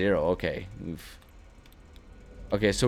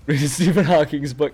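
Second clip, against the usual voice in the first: laughing; 6 semitones higher, 6 dB louder than usual. Acted. Joking about robotic voice narration.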